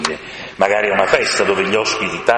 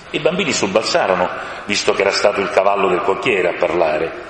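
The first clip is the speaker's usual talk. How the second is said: ordinary delivery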